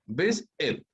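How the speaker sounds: visit drawn out slowly with the weight on VI; slow careful stress. Shown as a demonstration. Teacher repeating the correct visit slowly for students. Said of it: "la mayor fuerza de voz está adelante".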